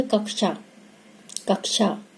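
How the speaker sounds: कक्षा said softly with a clear क्ष cluster; careful कक्षा. Shown as a demonstration. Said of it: "इस तरीके से उच्चारण करते हैं". (कक्षा का शुद्ध उच्चारण)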